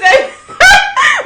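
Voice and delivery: high, loud burst of laughter; loud shrieking laugh. Real, not acted. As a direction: cackle out loud at the memory.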